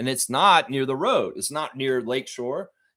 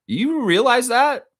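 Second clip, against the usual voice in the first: high, loud, slow indignant question; incredulous challenge; 13 semitones higher, 6 dB louder, slower than usual. Real, not acted. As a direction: demand he admits it. Scolding the player.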